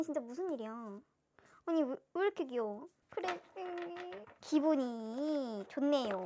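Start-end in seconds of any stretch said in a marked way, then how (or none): none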